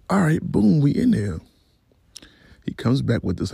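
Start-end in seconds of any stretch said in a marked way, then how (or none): 0.1-1.4 excited. Retold or imitated. Acted hopeful reaction to offered help.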